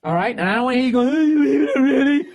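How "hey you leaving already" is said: much higher and louder, nagging mock voice; mocking whiny imitation. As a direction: parody her complaint, shrill-edged and loud. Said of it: "that's how she sounds when she says shit like that".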